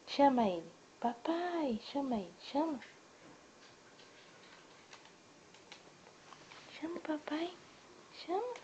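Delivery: soft high encouraging lilt. Real, not acted. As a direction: gently urge the little one to call. Coaxing child to call dad.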